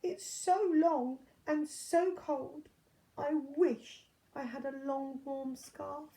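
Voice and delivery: high, slow, mournful giraffe voice; a sniffly, sorry-for-itself giraffe; sad giraffe. A narrator's reading. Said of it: "who was sniffing sadly".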